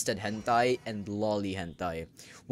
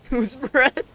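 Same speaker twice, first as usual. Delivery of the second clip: baffled squeal; 12 semitones higher, 6 dB louder than usual. Real, not acted. Repeating the name ever louder.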